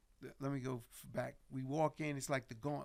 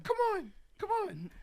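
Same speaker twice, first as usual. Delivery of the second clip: high, loud beckoning impression; 9 dB louder than usual. Star urging guests to follow him, high mimicked voice. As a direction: imitate the star calling out in a high voice. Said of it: "He's like".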